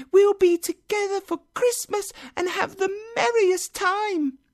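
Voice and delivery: put-on very high, childlike chatter; bubbling small girl's squeaky voice; happy little girl. A narrator's reading. Little sister promises her brother Christmas at home, happily. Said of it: "said the girl happily".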